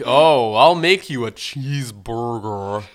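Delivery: loud, hammed-up indignant chef; mock indignant chef. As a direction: puff up like an insulted chef. Chef voiced, goaded into cooking.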